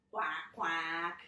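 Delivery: low-pitched quacking; duck quack. A narrator's reading. Reader retelling George's answer, low quacking. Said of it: "and he said".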